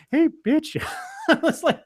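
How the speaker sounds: high, laughing hey bitch. Retold or imitated. Punchline retold through laughter.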